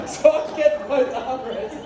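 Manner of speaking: slow, high, laughing; laughing reply. Retold or imitated. Comic telling the neighbour why, slow laughing. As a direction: answer through laughter. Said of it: "And I go".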